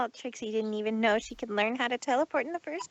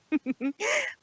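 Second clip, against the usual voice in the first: snobby cackle; 9 semitones higher than usual. Acted. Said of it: "she said with a cackle".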